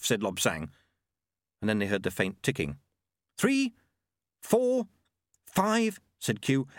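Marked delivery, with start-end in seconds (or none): 3.4-6.0 high-pitched, separated counts; tense countdown. Acted. Monk counting before the bowl explodes, tense. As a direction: count off each tick in a raised voice.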